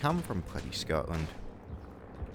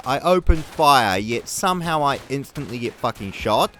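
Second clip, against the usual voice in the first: angry outburst; 5 dB louder than usual. Real, not acted. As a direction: shout your frustration at the game, swearing hard. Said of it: "me being slightly angry at them".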